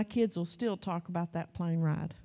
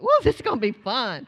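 louder put-on glee; fake cheer; 2 semitones higher, 6 dB louder than usual. Mother repeating fake fun to her kids, louder put-on glee. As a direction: pretend it's a ride. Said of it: "I'm just lying".

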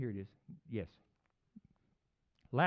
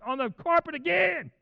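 loud, high angry yell; acted furious scream; screaming at dog; 13 semitones higher, 10 dB louder than usual. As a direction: scold the dog at full voice. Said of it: "I could be screaming at him".

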